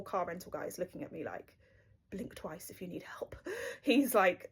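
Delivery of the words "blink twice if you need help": hushed unvoiced whisper; whispered aside. Rental clerk silently asking if she's in danger, whispered. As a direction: breathe it like a secret signal.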